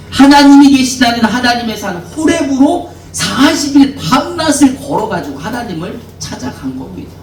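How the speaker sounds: ordinary talk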